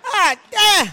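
very high, loud shout. Real, not acted. Cracking herself up before the crowd, a high shout. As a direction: holler it in delight. Exclaiming at her own story.

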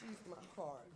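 barely above a whisper, very soft; hushed tender. Real, not acted. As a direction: breathe it out tenderly. Says it twice, hushed.

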